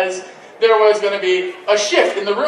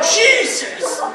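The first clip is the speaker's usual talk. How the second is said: 1 amazed laughing; 10 semitones higher, slower than usual. Retold or imitated. Amazed watching the twinks.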